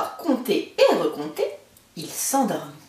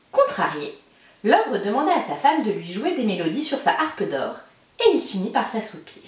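ordinary delivery, nothing marked

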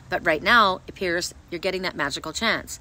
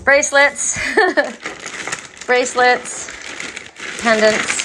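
excited listing; 6 semitones higher, 6 dB louder, slower than usual. Real, not acted.

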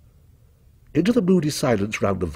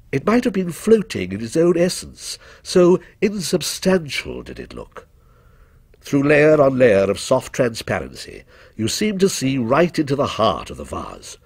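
usual speaking voice

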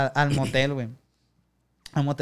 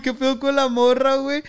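incredulous; 10 semitones higher, 5 dB louder than usual. Retold or imitated. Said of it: "Yo, güey".

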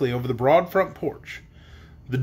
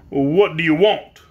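slow, loud gruff man; stern, gruff; 6 dB louder, slower than usual. A narrator's reading. Unseen man challenging strangers, stern and gruff. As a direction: demand it sternly and slowly. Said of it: "in a stern, gruff accent".

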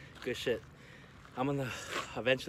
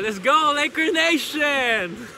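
fan shout; 14 semitones higher, 8 dB louder, much slower than usual. Real, not acted. Cheering for the Lakers.

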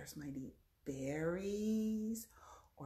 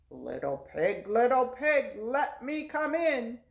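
wolf voice; 6 semitones higher, 12 dB louder than usual. Acted. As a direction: growl at the door like the wolf. Wolf at the pig's door.

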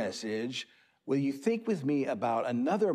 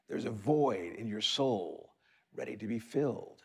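usual speaking voice

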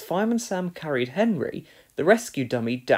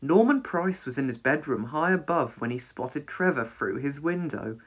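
plain reading voice

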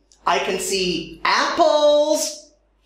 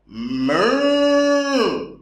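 playful mooing like a cow. Pretend. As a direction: moo like a cow for kids. Cow sound after naming cow.

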